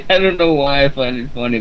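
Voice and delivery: ordinary delivery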